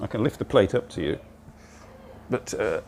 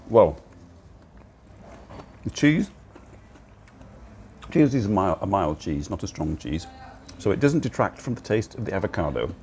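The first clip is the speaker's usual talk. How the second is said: mouth full; 4 dB quieter than usual. Real, not acted. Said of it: "I apologise for talking with my mouth full".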